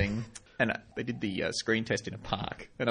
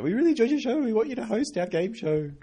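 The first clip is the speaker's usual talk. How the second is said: high gushing fan voice, much higher; doe-eyed young fans; 12 semitones higher than usual. Retold or imitated. Two young fans gushing at the comedian, high and starry-eyed. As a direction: gush like starstruck young pups. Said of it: "with big doe eyes and went".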